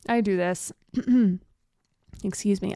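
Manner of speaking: normal talking voice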